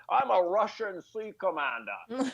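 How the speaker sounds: slow, laughing, lightly Scottish Connery; a hint of Connery's Scottish accent, drawn out; Sean Connery impression. Comedian mocks Connery as a Russian commander, lightly Scottish. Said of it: "and it's like".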